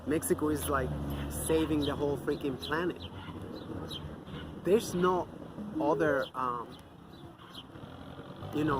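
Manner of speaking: ordinary delivery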